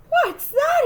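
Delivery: high, loud panicked shout; frightened cry. A narrator's reading. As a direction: yell it in fright. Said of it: "he cried".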